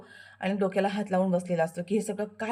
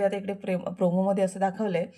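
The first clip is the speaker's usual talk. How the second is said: usual speaking voice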